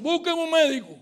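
loud, high call for help; urgent call. Retold or imitated. Professor reacting to a screaming student, acted urgent call. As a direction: call out urgently, re-enacting the emergency.

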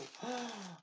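quick breathy gasp of wonder; surprised little intake of breath; soft delighted gasp. Real, not acted. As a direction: gasp as if seeing treasure. Seeing the balloon embellishments.

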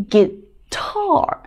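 "guitar" with a long, stressed "tar"; short "gui", then lean on "tar" in "guitar"; stress on second syllable. Shown as a demonstration. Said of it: "后面这个部分呢就读重一点".

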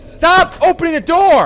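high, loud, pleading order; desperate shout. Real, not acted. Scared player yells at the door, loud shout.

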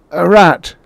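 put-on high, loud, frightened old man; shaking tones. A narrator's reading. Old man lying to his wife about the knock, shaking tones. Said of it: "said the old man in shaking tones".

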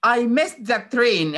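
I missed the train said slowly and clearly; slow example sentence. Shown as a demonstration. Teacher giving a slow example to the kids. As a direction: lay each word out for the class. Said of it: "مثلًا لما تيجي تقول ايه".

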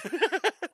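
very high giggle; high laugh. Real, not acted. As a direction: giggle at your own joke. Laughing at his own joke.

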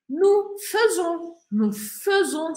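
"Nous faisons" slow, fai said as fe, fezon; Spanish: "Nous faisons" lento, se dice fesón; slow model. Shown as a demonstration. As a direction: model it slowly for repeating. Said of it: "pero pronuncio faisons".

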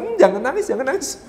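high-pitched cooing hush; parent hushing child. Acted. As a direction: imitate a parent soothing a crying child. Parent hushing crying child, acted.

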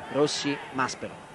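ordinary talk, nothing marked

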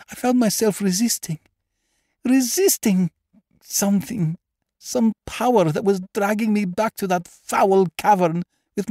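high, anguished voice of a haunted woman; distressed Lady Lashmore. Acted. Frightened patient confessing her dream to the doctor, high and distraught. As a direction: build from uneasy recall to high-pitched dread.

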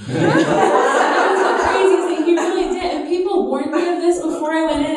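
laughing hard while talking; breaking into laughter at the memory. Real, not acted.

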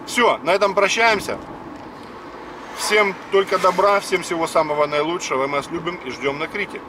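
ordinary talk

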